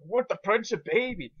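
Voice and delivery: lightly voiced Fat Bastard, slow and high; a hint of a slow, dopey brute. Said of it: "fat bastard's voice in my head".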